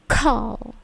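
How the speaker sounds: "call" with a strong puff of air after the K; drawn-out "call", K bursting with air; plosive K. Shown as a demonstration. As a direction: say "call" slowly with an aspirated K. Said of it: "K-nya itu plosif".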